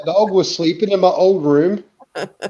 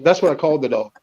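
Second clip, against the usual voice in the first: ordinary talk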